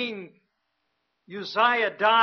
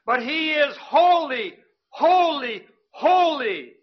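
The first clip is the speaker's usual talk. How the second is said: each holy higher and louder, falling; emphatic holy, holy, holy; 4 semitones higher, 5 dB louder than usual. Real, not acted. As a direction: proclaim every holy with weight. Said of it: "We cannot understand and describe the holiness".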